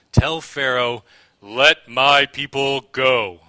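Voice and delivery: slow, booming, commanding proclamation, each word drawn out; Charlton Heston impression. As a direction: thunder it out slowly like a grand epic prophet. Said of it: "That was my best Charlton Heston impression".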